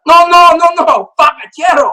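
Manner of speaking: high, loud excited cry of pacachero; excited client yelling. Retold or imitated. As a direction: call out the word like he's spotted something. Client insisting again.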